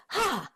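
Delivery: one high, soft, breathy "Ha"; a quick light high "Ha"; clearing "ha". Shown as a demonstration. Showing the energy-cutting sound, a single high soft ha. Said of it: "Same thing".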